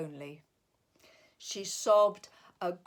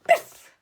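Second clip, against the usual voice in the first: loud snowball thwack; 15 dB louder than usual. Acted. Snowball hit.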